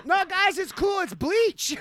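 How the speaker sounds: high-pitched put-on voice; jokey high voice, insisting all is fine; mock reassurance. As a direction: reassure the guys in a squeaky pitch.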